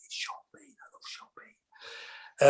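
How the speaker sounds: hushed, slowed whisper of awe; starstruck whisper. Retold or imitated. Actor starstruck on meeting his hero, whispering. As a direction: breathe it out like a fan in awe. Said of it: "and I'm like".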